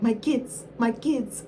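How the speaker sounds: a mild mock of Amara on "My kids, my kids"; mocking [name]. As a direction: copy the reviewer mocking Amara. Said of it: "and she'd be like".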